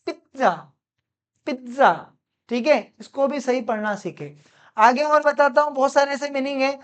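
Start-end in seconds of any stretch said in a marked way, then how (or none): 0.1-1.9 pिज्जा said as "PIT-za", with a crisp t before the z; correct pronunciation. Shown as a demonstration. Teacher models पिज्जा for students, pronounced "pit-za". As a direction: say पिज्जा as "pit-za", split in two. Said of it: "इसको हम बोलते हैं".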